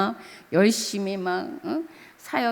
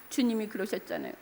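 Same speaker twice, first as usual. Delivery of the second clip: tearful murmur; 10 dB quieter, faster than usual. Retold or imitated. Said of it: "눈물 콧물 흘리면서".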